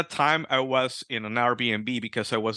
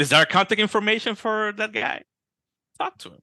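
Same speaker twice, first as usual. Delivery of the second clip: raised, eager wife voice; wife's voice; 8 semitones higher than usual. Retold or imitated. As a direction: quote the wife, higher and excited. Wife voiced in retold story.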